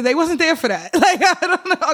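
cracking up while talking; laughing. Real, not acted. Laughing at friend's story.

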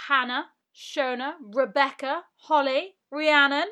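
loud roll-call, building; rising celebratory list of names; shout-out. Real, not acted.

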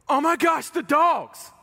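a hammed-up gasp of realization; mock panic. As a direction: blurt it out as if just remembering. Sudden memory of forgotten dogs.

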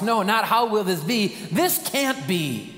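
mild mock rooftop shout; defiant shout. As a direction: cry out in unbelief. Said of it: "shout from the rooftops".

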